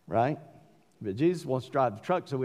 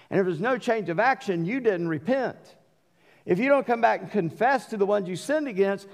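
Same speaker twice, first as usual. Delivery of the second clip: full-force preaching; 7 semitones higher than usual. Acted. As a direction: preach it hard to the congregation. Preaching on repentance.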